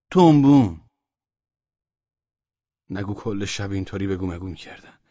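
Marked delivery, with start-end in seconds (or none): 0.1-0.8 loud, higher reply; shouted answer. A narrator's reading. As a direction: call the word back loudly. (جواب با فریاد)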